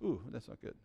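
acted stifled laugh, then a soft tut-tutting remark; guilty chuckle. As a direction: chuckle quietly as if you shouldn't. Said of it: "you kinda go".

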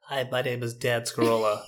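nasally nerd introducing himself; nerdy young airman. Shown as a demonstration. Host demonstrating his general young airman voice, nerdy and nasal. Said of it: "It's like a nasally".

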